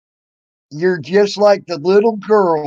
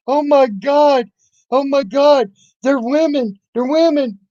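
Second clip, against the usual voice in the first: mocking Tal; 10 semitones higher than usual. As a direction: parody her fussing in a high voice. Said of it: "And then you got Tal".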